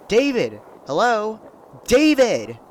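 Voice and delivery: loud calls through the house; calling out. Acted. Mom calling upstairs for her husband, shouting. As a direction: shout his name to find him.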